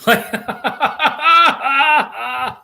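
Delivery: a burst of high laughter. Real, not acted. Laughing at the chat.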